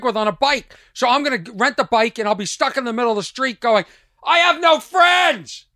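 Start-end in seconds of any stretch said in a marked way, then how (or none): 4.2-5.6 shouting. Pretend. Imagined yelling alone in street.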